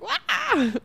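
high cartoon crying wail; mock wail. Retelling her cry to Faraday, a high mock wail. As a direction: imitate bursting into tears with a silly wah. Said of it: "And I just started crying. I was like".

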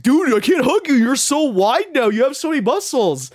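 high, loud, amazed gushing; excited gushing. Retold or imitated. Friend marvelling at his new muscles, high excited gushing. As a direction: rave over him like he's unrecognizable.

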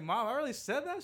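very high, loud whine; mock childish whine; whiny kid protest. Child self protesting to mom.

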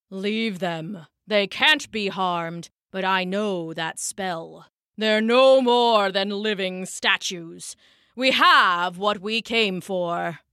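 measured, dismissive dark sorcerer; commanding warlock. A narrator's reading. Warlock calls off his minions from the trees, cold command.

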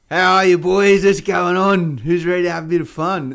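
loud, high, hammed-up hello to the lobby; loud cheery greeting. Mock greeting to the Call of Duty lobby, loud and high. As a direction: boom it out like a gamer joining a lobby.

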